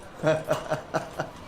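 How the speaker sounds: breathy chuckle. Acted. Doctor laughing at own joke.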